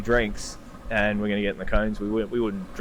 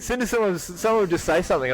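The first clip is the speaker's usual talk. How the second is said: higher, laughing through it; 8 semitones higher than usual. Real, not acted. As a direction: still giggling as you speak. Laughing at own story.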